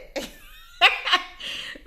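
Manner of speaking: a sudden, high, loud laugh that breaks out; helpless real laughter; burst of laughter. Real, not acted. Laughing at what the embassy lady said. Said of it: "I don't know why I'm laughing".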